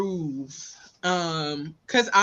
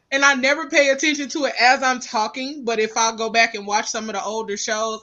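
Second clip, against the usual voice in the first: ordinary delivery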